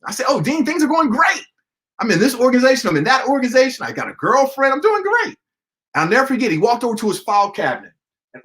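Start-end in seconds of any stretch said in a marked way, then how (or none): 0.3-5.4 high, upbeat bragging; eager freshman boasting his campus life; cocky young student. Retold or imitated. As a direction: brag brightly to the dean, pitched up. Young self bragging to dean.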